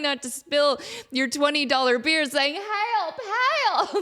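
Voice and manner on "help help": high, thin cry for help; half-strangled mock distress call; mock panicking mom. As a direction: imitate a helpless wail without much volume. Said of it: "I'm the mom panicking".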